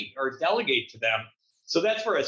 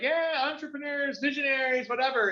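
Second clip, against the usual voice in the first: high, offhand mock of the crowd saying it; 11 semitones higher than usual. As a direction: toss it off high like a bored crowd. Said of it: "and were like".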